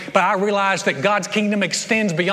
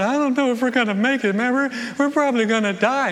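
higher, worried put-on voice of a doubting leper; 5 semitones higher than usual. Leper doubting to his friends, in a higher put-on voice. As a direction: play the gloomy one of the group.

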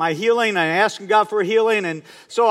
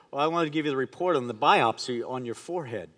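low, soft retelling of a doctor's call; grave doctor; 6 semitones lower, 9 dB quieter than usual. Retold or imitated. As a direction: break bad news quietly, as the doctor. Doctor phones with bad biopsy news.

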